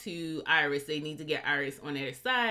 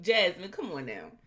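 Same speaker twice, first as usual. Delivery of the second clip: normal talking voice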